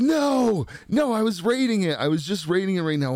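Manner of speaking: hammed-up cry of dismay, then speeding up; mock protest. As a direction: protest like it was snatched from you. Acts out protesting the auto flush.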